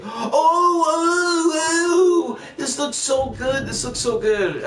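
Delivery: high, squealing mock gush over a trailer; mocking gushing fans. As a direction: squeal it like an overexcited fan, hammed-up. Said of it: "Everybody's like".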